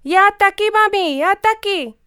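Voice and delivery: put-on high, loud little girl's cry; child shouting. A narrator's reading. Little girl on the bank shouting to mum that she wants a turn, high and loud. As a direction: yell from the poolside wanting to join in. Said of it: "z břehu křičela".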